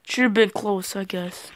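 ordinary delivery

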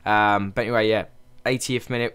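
ordinary talk, nothing marked

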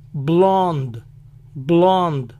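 "blonde" as "blahnd", silent final E; American "blonde" ending on D; English silent ending. Shown as a demonstration. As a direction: say "blonde" in one syllable. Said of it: "La I final no se pronuncia".

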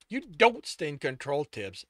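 loud angry accusation; snarled. A narrator's reading. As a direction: throw it at them, dropping on the name. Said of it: "Sto snarled".

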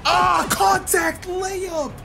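high, drawn-out shout; slow, high yell of excitement; excited shout. Real, not acted. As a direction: stretch it out in hype. Shouting at a contested layup.

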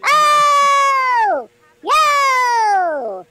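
long, loud high-pitched cry; drawn-out holler. Real, not acted. Streamer reacting in the game, long loud holler. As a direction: holler it out, stretching each word.

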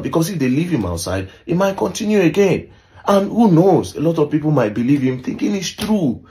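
very high, worked-up retold complaint; emotional retelling. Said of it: "David was really emotional".

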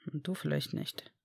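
Harry mumbling back at Draco, soft and slow; mumbled. A narrator's reading. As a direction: grumble it under your breath, slowly. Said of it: "nuschelte Harry".

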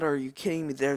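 normal talking voice

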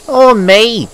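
high, loud wounded pride; indignant. A narrator's reading. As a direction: push it up high and loud in protest. James joins engines' jealous complaints.

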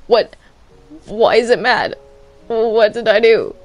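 ordinary talk, nothing marked

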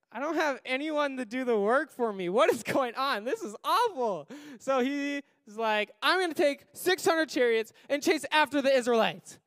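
fussing king who has lost his workers; lightly voiced Pharaoh. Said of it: "But Pharaoh was like".

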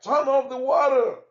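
high, loud annoyed cry; shouting. A narrator's reading. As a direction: yell it across the town. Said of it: "The people in the town cried".

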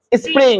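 স্প্রিং in one quick spr cluster; quick, joined-up স্প্রিং; correct quick pronunciation. Shown as a demonstration. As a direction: say স্প্রিং fast, no vowel after p. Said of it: "দ্রুত".

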